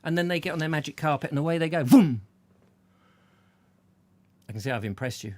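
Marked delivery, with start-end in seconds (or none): 1.8-2.2 vroom sound effect. Retold or imitated. Carpet flying away.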